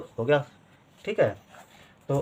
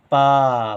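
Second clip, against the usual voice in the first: पा held long, a little louder; drawn-out vowel; 5 dB louder than usual. Shown as a demonstration. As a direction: stretch the long aa in पा. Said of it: "ज़्यादा देर तक उच्चारण".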